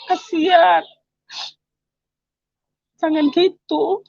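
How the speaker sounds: mother begging her son to stop drinking, tearful; tearful plea. Retold or imitated. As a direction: voice catching, a touch of crying.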